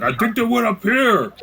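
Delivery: high, far-carrying orc call. Acted. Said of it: "you can start hearing them".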